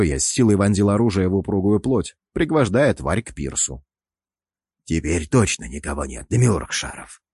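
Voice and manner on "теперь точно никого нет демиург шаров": low sheepish frog croak; ashamed croaking. Acted. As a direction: croak it out guiltily, dropped low. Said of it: "пристыжённо проквакал".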